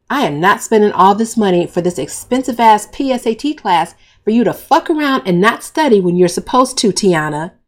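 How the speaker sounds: loud heated dressing-down; 母亲为学费大声训斥女儿; angry lecture. Acted. Mother scolds daughter over money.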